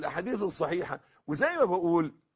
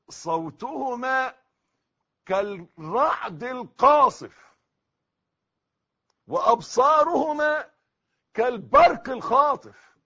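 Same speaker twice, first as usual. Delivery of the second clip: slow, loud, high-pitched preaching; thunderous declamation; 9 semitones higher, 10 dB louder, slower than usual. Shown as a demonstration. Preacher quoting the Prophet on the angels' terror, thunderous declamation. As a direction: hurl each phrase out slowly like a thunderclap.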